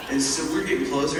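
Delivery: ordinary delivery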